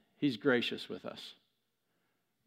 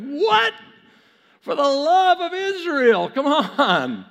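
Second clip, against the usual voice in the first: very high, loud comic outburst; exasperated disbelief; 12 semitones higher, 10 dB louder, slower than usual. Acted. Gideon told he still has too many, exasperated. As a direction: cry out in hammed-up exasperation.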